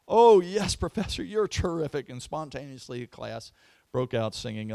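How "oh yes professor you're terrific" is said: high, loud gushing thanks; gushing students. Retold or imitated. Relieved students gushing to their professor, high and loud.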